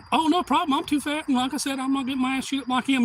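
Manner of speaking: high, hurried suspect voice; imitating a man keen to stay put; acted compliant suspect. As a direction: babble eager compliance.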